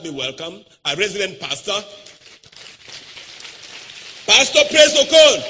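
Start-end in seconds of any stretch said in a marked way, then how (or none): 4.3-5.4 high, loud announcement; announcer call. Real, not acted. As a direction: announce him with a big build. Announcing the pastor.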